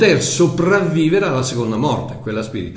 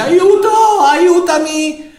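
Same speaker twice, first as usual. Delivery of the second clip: high, loud, pleading cry of a dying man; a loud, high plea for help, voice strained with need; desperate cry for help; 8 semitones higher, 4 dB louder than usual. Acted. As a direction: beg for rescue as if dying of thirst. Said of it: "il tuo cuore che dice".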